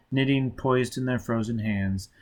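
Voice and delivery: ordinary delivery, nothing marked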